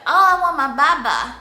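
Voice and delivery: put-on high loud babyish whine; mock a toddler in baby talk. Mom mocking baby talk she avoids, high babyish whine. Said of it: "You don't want them to be like".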